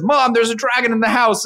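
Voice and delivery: put-on high-pitched kid pleading to be believed; insistent story kid.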